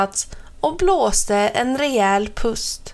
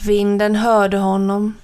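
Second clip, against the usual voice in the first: ordinary delivery, nothing marked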